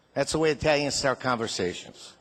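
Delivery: ordinary talk, nothing marked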